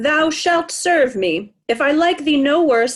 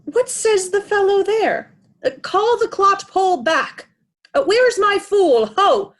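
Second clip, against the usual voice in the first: impatient calling out; 7 semitones higher than usual. Acted. King calling for his fool.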